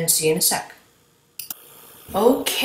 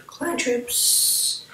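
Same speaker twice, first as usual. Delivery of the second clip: voice cracking; 5 semitones higher, 6 dB quieter than usual. Real, not acted. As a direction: voice cracks high mid-phrase. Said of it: "my voice just cracked".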